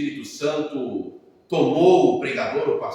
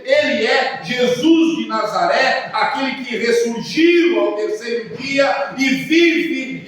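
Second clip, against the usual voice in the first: loud, high, rising preacher proclamation; fervent pulpit declaration, higher and louder; preaching at full force; 10 semitones higher, 9 dB louder than usual. Acted. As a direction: build to a triumphant climax. Preaching climax.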